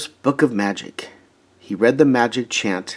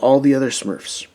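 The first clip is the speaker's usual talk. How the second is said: plain reading voice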